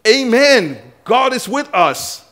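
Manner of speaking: high, loud and drawn out; slow, ringing preacher's shout; triumphant proclamation. Acted. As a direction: belt it out to rally a crowd. Acting out Moses' triumphant message.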